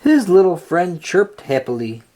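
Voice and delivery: ordinary delivery, nothing marked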